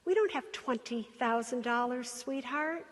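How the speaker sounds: soft, tender motherly voice; gentle mother. Retold or imitated. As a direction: let her down gently, softly. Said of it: "And she says".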